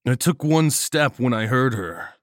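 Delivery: plain reading voice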